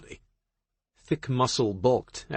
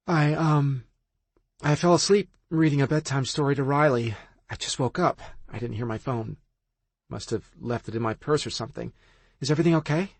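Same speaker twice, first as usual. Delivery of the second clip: lightly voiced drowsy woman; sleepy act; 5 semitones higher than usual. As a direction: pretend you just woke up. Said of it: "feigned a yawn".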